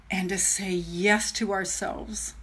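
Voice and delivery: slow, soft, near whisper; hushed earnest appeal. Real, not acted. Urging viewers to back each other, hushed and earnest. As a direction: let it sink to an intimate hush.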